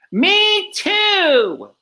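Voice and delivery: high, loud little joey voice; little kangaroo. A narrator's reading. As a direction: squeal it like a tiny joey. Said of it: "the young kangaroo in her pouch said".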